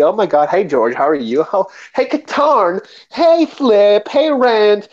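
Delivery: imitating a campy, gushing guy, pitch climbing on each name; mock flamboyant voice. Mocking a flamboyant stereotype.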